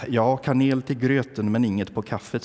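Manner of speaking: normal talking voice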